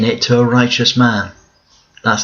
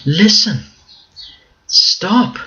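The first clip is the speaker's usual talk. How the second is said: much higher, impatient retort; exasperated Bildad; 10 semitones higher than usual. Retold or imitated. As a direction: cut Job off, fed up with his talking. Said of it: "He says to Job".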